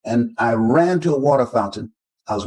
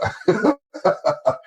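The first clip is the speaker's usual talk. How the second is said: breathy, airy chuckle; real warm laugh. Real, not acted. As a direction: laugh fondly at himself. Laughing at "mama's baby boy.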